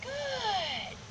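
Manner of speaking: gentle high praise for the dog; high soft praise. Real, not acted.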